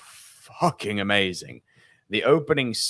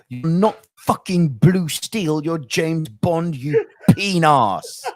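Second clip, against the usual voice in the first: slow, higher, louder mock telling-off; mock scolding; 6 semitones higher, 4 dB louder, slower than usual. Ranting at Daniel Craig's Bond for his pout, slow mock scolding. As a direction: lecture him slowly with a laugh at the end.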